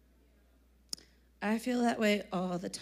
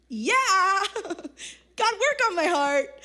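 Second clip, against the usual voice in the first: higher and louder, laughing through a slow prayer; 7 semitones higher, 5 dB louder, much slower than usual. Retold or imitated. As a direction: giggle your way into the plea.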